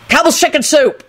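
high, urgent shout. A narrator's reading. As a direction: shout it like a password. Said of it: "Lupin shouted at the gargoyle".

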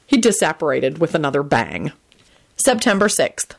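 plain reading voice

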